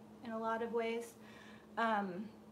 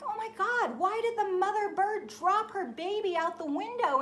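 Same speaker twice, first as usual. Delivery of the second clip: much higher, aghast outcry; shocked; 9 semitones higher, 5 dB louder than usual. Retold or imitated. Dreamer watching the baby bird fall, shocked. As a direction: gasp it out in disbelief. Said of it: "And I'm like".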